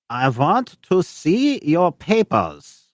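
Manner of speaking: high, slow, put-on guard voice; German guard in broken French. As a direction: demand papers like a stern foreign soldier. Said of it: "he goes".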